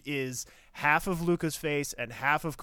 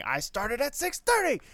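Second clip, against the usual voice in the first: much higher, louder indignant yell; 12 semitones higher, 5 dB louder than usual. Retold or imitated. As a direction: yell it in outrage. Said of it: "So her yelling".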